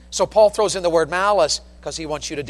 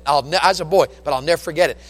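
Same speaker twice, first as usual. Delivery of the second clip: usual speaking voice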